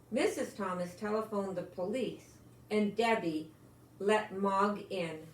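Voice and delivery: ordinary delivery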